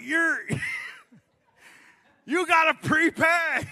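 high, loud, slow shout at the deaf man; exasperated shout. Retold or imitated. Homeless man shouts at the pretend deaf customer, loud and high. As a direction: yell it out so he finally gets it. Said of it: "The guy's like".

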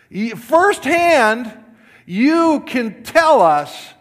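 rally the congregation; full-force preaching. Acted. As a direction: pastor urging the church to testify, loud and slow.

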